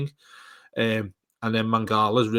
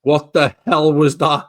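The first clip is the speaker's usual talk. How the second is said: surprised; 4 semitones higher, 9 dB louder, slower than usual. Real, not acted. Reacting to silly accent.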